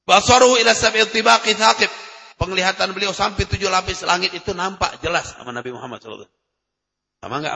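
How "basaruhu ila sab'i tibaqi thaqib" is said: raised, measured Arabic recitation of a hadith. Acted. Preacher recites the hadith to the congregation, solemn and measured.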